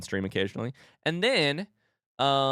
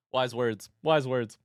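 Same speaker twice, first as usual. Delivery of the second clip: ordinary talk, nothing marked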